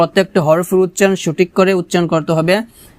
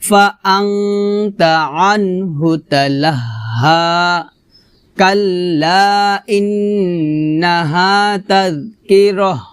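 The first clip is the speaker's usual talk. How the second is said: slow, measured tilawah with long drawn vowels; Quran recitation; much slower than usual. Acted. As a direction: recite for students to copy. Teacher recites Surah Abasa. (ধীরে ধীরে তিলাওয়াত, শিক্ষার্থীদের অনুসরণের জন্য)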